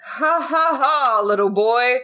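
very slow, drawn-out jolly Santa voice. Acted. Santa gently scolding a fire-lighting boy, slow and drawn out. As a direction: stretch it into a patronising ho-ho scolding. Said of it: "suddenly Santa goes".

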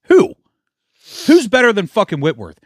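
high indignant outburst. Real, not acted. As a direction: shout it, outraged. Angry fan rant.

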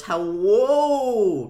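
Winnie impression. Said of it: "she goes".

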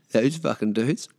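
normal talking voice

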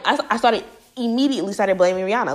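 ordinary delivery

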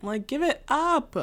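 mocking sass. Mocking [name]'s persona.

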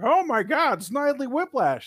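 high, loud mocking exclamation; mock friend reaction. Imitating old friends gasping at the Vince photo, high and loud. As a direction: squeal it like a shocked friend.

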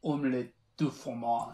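put-on playful French accent; mock French accent. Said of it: "sing in a French accent".

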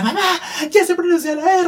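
high, thrilled shout to mom; a little boy bursting with his news; excited child. Retold or imitated. A boy runs to the kitchen to tell mom, thrilled shout. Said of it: "Salí corriendo a la cocina".

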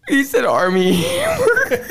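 high, slow words through laughter; laughing helplessly. Real, not acted. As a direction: barely get it out for laughing.

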